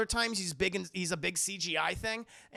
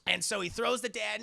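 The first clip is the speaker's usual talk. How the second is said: usual speaking voice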